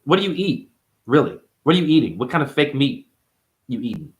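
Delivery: ordinary delivery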